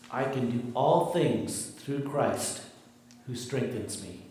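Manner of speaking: ordinary talk, nothing marked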